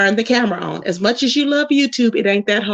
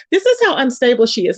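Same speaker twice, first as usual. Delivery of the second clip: ordinary talk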